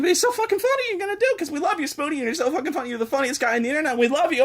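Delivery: high, fast gushing fan impression; high mock fan voice. Imitating fans coaxing him to play. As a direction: gush like a pushy fan, high. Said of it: "And then they're like".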